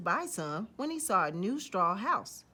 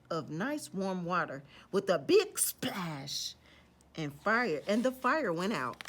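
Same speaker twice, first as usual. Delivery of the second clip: ordinary delivery, nothing marked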